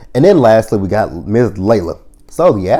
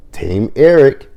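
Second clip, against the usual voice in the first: mock cheer; 5 semitones higher, 6 dB louder, slower than usual. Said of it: "He's like".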